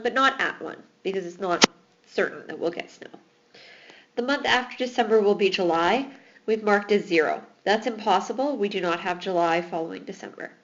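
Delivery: normal talking voice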